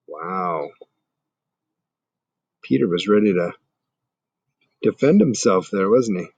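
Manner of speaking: ordinary delivery, nothing marked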